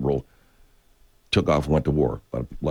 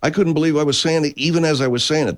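sergeant recalling; 10 semitones higher, 4 dB louder than usual. Retold or imitated. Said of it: "He goes".

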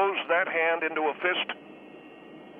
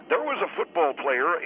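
usual speaking voice

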